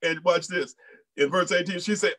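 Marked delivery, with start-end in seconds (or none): none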